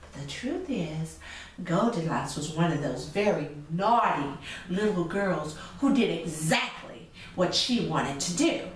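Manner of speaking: ordinary delivery, nothing marked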